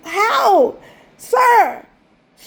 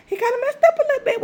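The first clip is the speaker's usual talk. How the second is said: much higher and quicker, playful; higher, faster mock repeat; 8 semitones higher, much faster than usual. Real, not acted. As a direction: toss it off high and fast.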